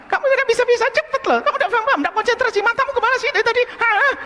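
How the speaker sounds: high, loud, fast scolding mother's voice; shrill mock nagging at a child; angry mother scolding. Mother scolding her child over Quran lessons, high and fast. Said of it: "banyak marahnya".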